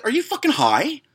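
high-pitched indignant question; incredulous. Retold or imitated. Customer answering a stripper's demand for money, incredulous. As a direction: throw it back in high disbelief.

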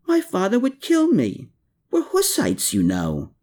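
put-on a high, exclaiming girl's voice; exclaimed. A narrator's reading. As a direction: burst out, scandalised. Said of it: "she exclaimed".

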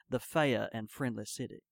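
put-on slow, drawn-out Southern Tidewater accent, dropped r in fair. Quoting the town's nickname as its locals would, Tidewater Southern accent. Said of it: "or as they would say".

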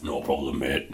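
low, soft sidekick voice; Ray's voice. Acted. Low, soft reply from Ray to David. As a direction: murmur it as a timid bath toy.

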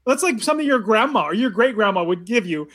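usual speaking voice

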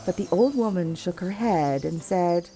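usual speaking voice

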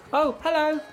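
put-on very high, squeaky little gnome girl; gnome innkeeper. Innkeeper greeting a guest in a very high gnome voice. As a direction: pitch it way up like a tiny eager gnome.

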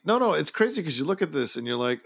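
usual speaking voice

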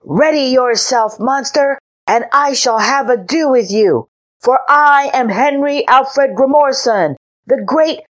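little knight challenging the sea monster; boyish shout. A narrator's reading. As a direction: bellow the title like a proud child. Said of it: "and shouted".

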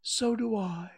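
Pooh. A narrator's reading. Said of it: "And Pooh said".

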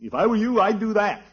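high, pompous mimicry of Bronco; mocking Bronco. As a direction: ape the bossy nephew's advice. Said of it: "he tells me".

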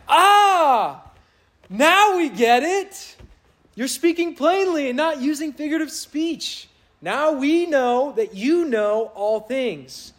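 high, slow, smug sing-song; mock their proud know-it-all tone; mocking the disciples. Pastor mocking the disciples' proud confession, high and smug. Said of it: "I'm mocking them if you can't tell".

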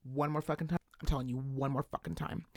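low, soft menacing mutter; low threat. Retold or imitated. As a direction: seethe it quietly to the side. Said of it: "Bill would be like".

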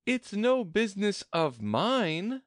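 high-pitched dismissive retort; plucky girl-character voice, high; bold Alice. A narrator's reading. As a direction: brush it off boldly, pitched high. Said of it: "surprised at her own courage".

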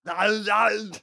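Austrian-accented Arnold impression, loud; heavy Austrian accent, Arnold style; Schwarzenegger impression. Friends mocking Arnold, Austrian-accented loud no. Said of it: "it's just the way he talks".

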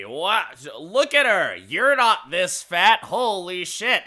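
loud, high sideshow pitch; carnival barker. Acted. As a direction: hawk a freak show in mock astonishment. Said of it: "you hear the barker doing the thing".

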